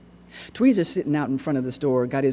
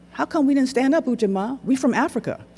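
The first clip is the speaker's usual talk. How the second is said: put-on high, eager boy's voice; high-voiced boy asking his friend; young boy Bo; 11 semitones higher than usual. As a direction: pitch way up as a puzzled kid.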